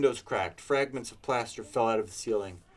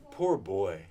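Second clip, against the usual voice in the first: pity; 3 semitones lower than usual. A narrator's reading. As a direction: sigh "Poor boy" tenderly. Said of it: "in pity for his former self".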